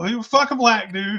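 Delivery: ordinary talk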